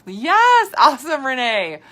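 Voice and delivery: high and loud, cheering a viewer on; excited cheer. Real, not acted. Host cheering a viewer who binged the podcast, loud high excitement. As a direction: cheer her name out loud.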